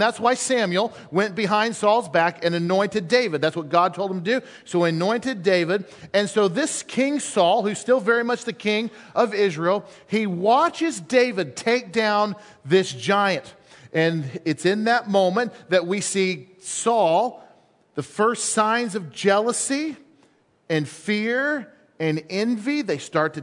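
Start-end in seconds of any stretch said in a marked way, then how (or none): none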